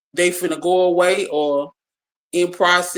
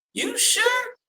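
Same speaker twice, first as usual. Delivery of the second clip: much higher, amazed grandma asking; 4 dB quieter than usual. Retold or imitated. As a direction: pitch it way up like a stunned grandmother.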